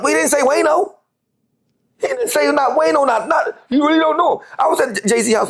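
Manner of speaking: high, sneering parody of Wayno; high mock voice throwing his words back. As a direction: pitch it up and ridicule the claim. Mocking Wayno's dismissive claim.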